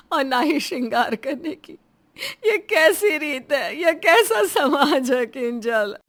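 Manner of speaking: anguished, crying lament, slowing down; tearful sobbing. Acted. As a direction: wail the questions through tears. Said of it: "बिलख बिलख कर रोती है". (रोते हुए दर्द भरी आवाज़ में)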